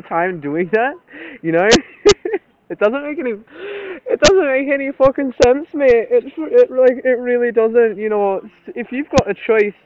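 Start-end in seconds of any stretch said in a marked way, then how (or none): 2.7-9.7 put-on slipping into a rough, half-hearted Scottish accent; a wobbly put-on Scottish accent, half joking; broken Scottish accent. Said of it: "I started speaking in, like, a broken Scottish accent".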